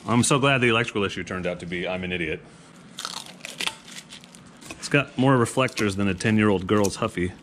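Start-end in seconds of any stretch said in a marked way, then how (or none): none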